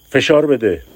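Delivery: high loud call to push; urgent shout. Acted. دستور هنگام زایمان. (فریاد بلند و فوری)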